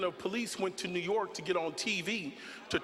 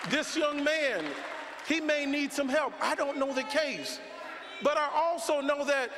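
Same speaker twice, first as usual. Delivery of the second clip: preaching at full force; 4 semitones higher than usual. Real, not acted. Preacher challenging the crowd over an actor's case, in full preaching voice. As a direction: hammer each question at the crowd.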